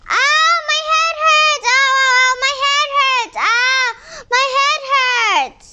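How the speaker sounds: put-on high, whimpering child's cry of pain; little boy crying in pain. Boy in the field cries out to his father, pained and whimpering. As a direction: wail it like a small boy with a sudden headache. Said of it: "the boy cried out".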